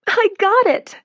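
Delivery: very high, loud cry; ecstatic. A narrator's reading. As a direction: shout the good news. Announcing news to family.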